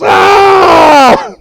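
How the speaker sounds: sudden high, loud cry of pain; pained shout. Acted. DeVito yells out high and loud when his knee cracks. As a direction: yelp as the knee gives way. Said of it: "And he goes".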